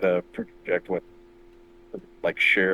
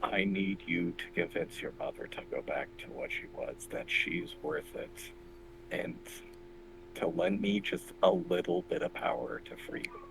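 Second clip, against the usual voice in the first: hushed, soft, much quieter; talking softly; 2 semitones lower, 12 dB quieter than usual. Acted. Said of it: "I'm trying to talk softly".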